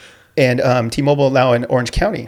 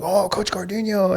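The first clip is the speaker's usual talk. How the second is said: high, slow, delighted call of his coach's name; light imitation of an eager former student; excited greeting; 7 semitones higher, 4 dB quieter than usual. Former student spotting his coach, high delighted greeting.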